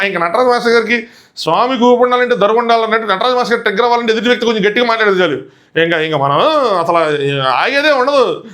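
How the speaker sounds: ordinary talk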